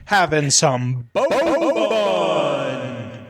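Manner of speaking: boat stretched into a long wobbly whoop; goofy relish. Acted. Gamer gushing about boat activity, goofy drawn-out relish. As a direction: draw the word boat way out, playfully. Playfully stretches the repeated phrase.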